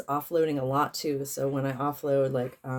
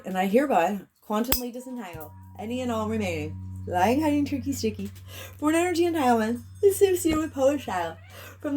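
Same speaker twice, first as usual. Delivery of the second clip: ritual incantation; 8 semitones higher than usual. Acted. Healer proclaims the clearing to the collective, raised invocation voice. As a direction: command the energy like a spell.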